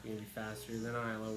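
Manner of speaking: usual speaking voice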